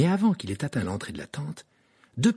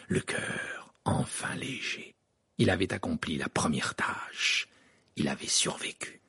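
hushed narration; 6 semitones lower, 5 dB quieter than usual. A narrator's reading.

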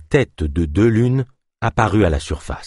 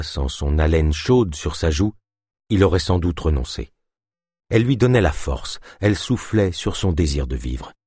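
ordinary delivery

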